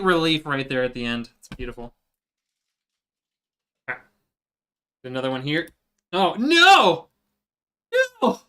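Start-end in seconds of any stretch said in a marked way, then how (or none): 6.1-8.4 dismayed cry. Real, not acted. A peel fails mid-build.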